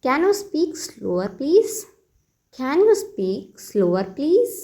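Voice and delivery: very slow, teacherly model phrase; slow model sentence. Shown as a demonstration. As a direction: spell it out slowly for learners. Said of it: "तो आप कहिए". (बहुत धीमे, सिखाने वाले अंदाज़ में)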